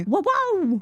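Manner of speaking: high-pitched celebratory whoa; amazed whoop. Real, not acted. Player celebrating one million simoleons, amazed. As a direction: whoop at a huge win.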